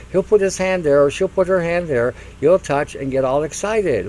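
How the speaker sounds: usual speaking voice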